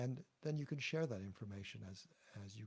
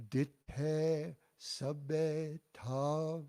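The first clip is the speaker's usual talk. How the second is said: "Ditte sabbe tav" very slowly and loudly, syllable by syllable, higher; slow model line; 4 semitones higher, 7 dB louder, much slower than usual. Shown as a demonstration. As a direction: draw out "Ditte sabbe tav" for the class to echo. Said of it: "And again".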